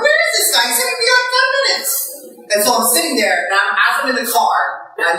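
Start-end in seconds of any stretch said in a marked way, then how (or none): 0.0-1.9 high, impatient pitch; shrill exasperated whine at the absent buddy. Retold or imitated. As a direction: squeak out your disbelief. Impatient waiting, voiced own thought.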